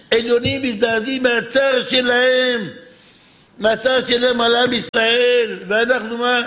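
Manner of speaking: rising, declaimed lament; fervent sermon intonation; impassioned preaching. Real, not acted. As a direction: proclaim heaven's grief to the congregation. Preaching at full force.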